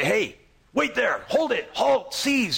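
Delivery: high, barked mock challenge; aggressive Philistine guards. Preacher voicing Philistine soldiers halting the Israelites, high mock shout. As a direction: shout them down like soldiers on a hill. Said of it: "if these Philistines are aggressive".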